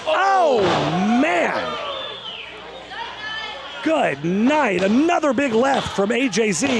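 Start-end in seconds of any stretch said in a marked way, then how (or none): none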